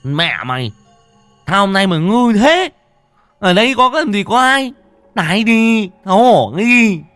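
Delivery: high, loud angry yell; drunken yelling. A narrator's reading. Drunk man yelling down at his crotch, shouting. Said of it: "nó chỉ vào dưới háng mà hét".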